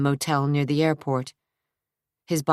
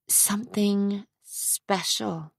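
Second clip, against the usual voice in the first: put-on soft, slow, secretive young woman; Trisha, hushed; 5 semitones higher, 6 dB quieter, slower than usual. A narrator's reading. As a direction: tease him with a secret, softly. Trisha's voice in story.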